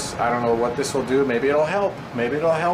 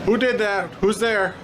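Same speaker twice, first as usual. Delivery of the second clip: scared calling out; 8 semitones higher than usual. Real, not acted. Calling out after noise.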